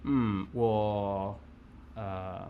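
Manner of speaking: normal talking voice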